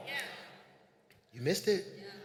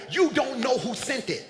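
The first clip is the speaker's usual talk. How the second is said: ordinary talk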